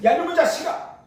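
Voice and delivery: 하나님의 분노를 흉내 낸 호통; 높게 꾸짖듯 외쳐. Retold or imitated. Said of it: "분노하는 화를 내시면서". (화내며 꾸짖는 하나님 흉내)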